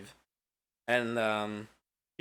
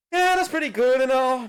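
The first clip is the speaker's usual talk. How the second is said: higher, louder put-on Travis voice; voicing Travis; 8 semitones higher, 7 dB louder than usual. Retelling the game, a host voices Travis higher and louder. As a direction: play it up as a hesitant Travis. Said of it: "Travis is like".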